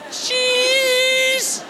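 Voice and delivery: high-pitched, strained photo squeal; mock-terrified child forcing a long cheese for the camera; drawn-out frightened squeak; scared kid's "cheese". Scared son posing for photo.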